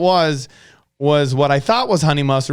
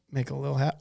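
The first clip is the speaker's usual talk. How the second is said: "Make a little hat" repeated much softer, almost to himself; quiet repeat; 11 dB quieter, faster than usual. Shown as a demonstration. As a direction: echo "hat" quietly under the breath.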